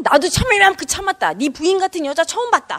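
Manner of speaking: high, indignant mother's outburst; acted anger. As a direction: play a fed-up mother scolding. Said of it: "막 화를 내면". (화내는 엄마 흉내로 높게 쏘아붙이듯)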